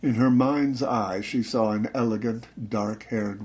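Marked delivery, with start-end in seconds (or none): none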